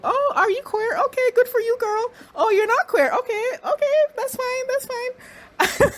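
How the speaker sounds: very high, put-on gushing voice; mock gushing. Mocking the cast's fake-supportive reaction in a very high voice. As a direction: coo it like a fake-supportive friend.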